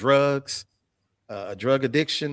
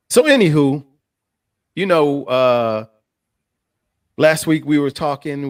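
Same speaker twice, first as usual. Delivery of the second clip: much louder announcing; loud restart; 2 semitones higher, 8 dB louder than usual. Real, not acted. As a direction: project it to the room.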